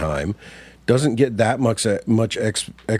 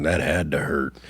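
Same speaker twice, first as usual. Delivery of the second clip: low, soft, dry villain delivery of "That had to hurt."; a lightly voiced low deadpan quip; atypical inflection; 6 semitones lower, 4 dB quieter than usual. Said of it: "atypical inflection, like he goes".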